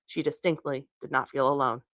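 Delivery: plain reading voice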